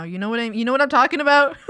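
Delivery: fast, laughing through it. Real, not acted. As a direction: giggle the question out. Laughing while repeating.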